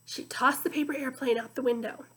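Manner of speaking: ordinary delivery, nothing marked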